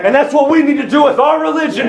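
high-pitched, fervent pulpit delivery; full-force preaching. Real, not acted. Preacher urging church to drop its religion, impassioned. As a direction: drive it home to the congregation.

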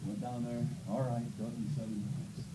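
low, soft reluctant grumble; mild sulky giving-in; grudging. Retold or imitated. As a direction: give in grudgingly. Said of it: "He said".

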